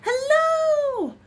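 very high, loud, held-out echo; echoed shout. A narrator's reading. Bear's voice bouncing off the mountains, echoing shout. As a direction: send it back like a mountain echo. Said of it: "his own voice echoed off the mountains".

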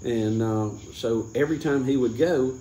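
ordinary talk

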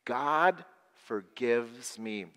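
very slow lead-along; slow group recital. Shown as a demonstration. Leading children to repeat the good news, slow. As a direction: stretch each word for the group to join. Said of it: "Say that with me".

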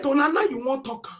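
usual speaking voice

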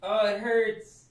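high, slow pained whine. Real, not acted. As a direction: let the sting come out in a high drawn-out "oh". Streamer reacting to stinging cut.

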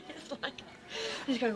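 ordinary talk, nothing marked

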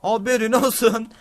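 usual speaking voice

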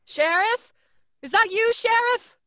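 loud high call into the dark. Acted. Doctor calling to sheriff across the road, loud.

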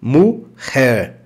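Mujer with throaty j, muher; rough j in Mujer; Spanish mujer. Shown as a demonstration. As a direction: Mujer said muxer. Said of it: "Grave esse detalhe da pronúncia".

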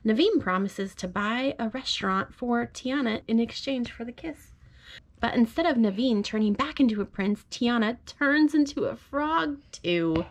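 gentle storybook read-aloud; reading to a child. Acted. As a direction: read it like a bedtime story to your little one. Parent reads picture book aloud.

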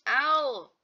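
ordinary talk

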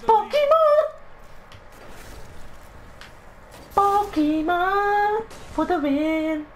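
very high, drawn-out falsetto cheer; happy squealing. Pretend. Gamer cheering for the win, happy squealing. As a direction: sing out a giddy high cheer. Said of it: "I'm in a happy mood".

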